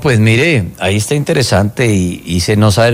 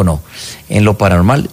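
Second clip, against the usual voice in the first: ordinary talk, nothing marked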